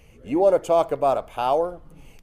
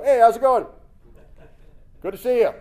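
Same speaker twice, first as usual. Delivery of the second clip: cheerful, raised, chatty; mock-casual friendly hello; breezy greeting; 7 semitones higher, 6 dB louder, faster than usual. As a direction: greet a ghost like a neighbor.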